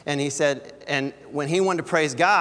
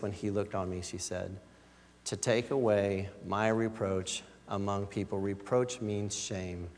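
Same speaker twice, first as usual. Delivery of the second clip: soft reflective preaching; 6 semitones lower, 5 dB quieter than usual. Acted. Pastor closing his sermon softly to the congregation, hushed and tender. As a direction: wind the sermon down gently, almost to a hush.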